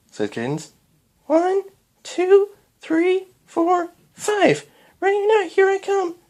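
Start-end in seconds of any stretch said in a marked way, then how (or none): 1.3-6.2 put-on very high little-girl counting call; childish shout. A narrator's reading. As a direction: call out as a child playing hide and seek. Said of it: "shouted Twilight".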